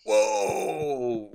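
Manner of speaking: loud alarmed exclamation; startled whoa. Real, not acted. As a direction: jolt back in surprise. Near miss in the game.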